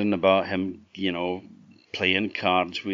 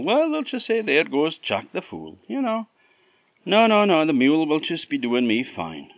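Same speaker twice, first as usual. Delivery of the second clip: high, quick, cheeky fool Jack; 8 semitones higher, 6 dB louder than usual. Acted. Jack refusing the fine mares, high and cheeky. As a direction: brush it off with a laugh in the voice.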